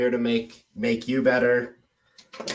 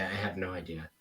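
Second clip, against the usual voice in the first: mock shrug, quiet; 5 semitones lower, 13 dB quieter, faster than usual. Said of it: "I'm gonna say".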